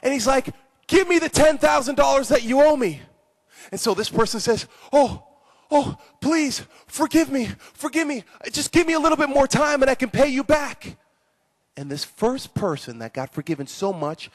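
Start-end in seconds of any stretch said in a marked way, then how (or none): 0.9-3.0 high, loud, insistent demand; accusing demand. Acted. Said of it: "He accuses the guy". 4.9-11.0 high-pitched, hammed-up begging for time; desperate plea. Second debtor pleads for more time.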